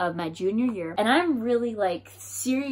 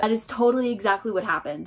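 normal talking voice

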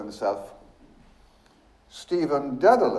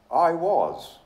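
higher, with a touch of fear; mildly fearful, energetic admission; energy and growing fear; 6 semitones higher than usual. A narrator's reading. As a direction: admit it with nervous energy. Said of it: "with energy and growing fear".

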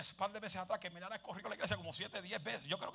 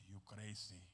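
muttered retort; 9 semitones lower, 11 dB quieter, much slower than usual. Retold or imitated. His own retort to God.

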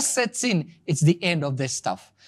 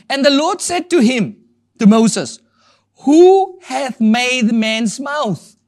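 raised, declaimed Bible reading, slowing grandly on the question; scripture reading; 6 semitones higher, 5 dB louder, slower than usual. Acted. Preacher reads God's words to Moses to the congregation, declaiming. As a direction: proclaim it louder and slower as you go.

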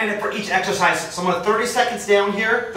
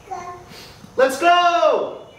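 high, loud rallying cry; excited cheer; 11 semitones higher, 3 dB louder, much slower than usual. Real, not acted. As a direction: fire everyone up. Cheering on his daughter.

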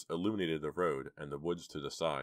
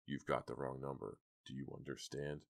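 low, quiet threatening voice; menacing thug; 3 semitones lower, 9 dB quieter than usual. Acted. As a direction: threaten him softly. Gruff man threatens Chuck.

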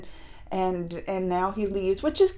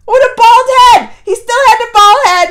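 amused disbelief; 10 semitones higher, 11 dB louder than usual. Real, not acted. Laughing at bald grown son.

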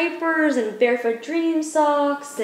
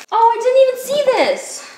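high, loud, caught off guard; sudden high delighted surprise; surprised; 12 semitones higher, 7 dB louder than usual. Real, not acted. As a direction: gasp it out at a surprise. Finds an unopened gift.

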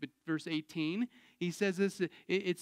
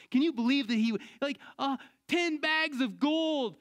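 incredulous; 7 semitones higher, 3 dB louder than usual. Real, not acted. Preacher marvelling at ungrateful servant.

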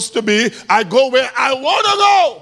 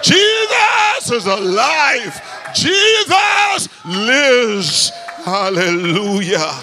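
preaching at full force; slower than usual. Acted. Preacher proclaiming to the church, slow and shouted. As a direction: stretch out each word and shout it.

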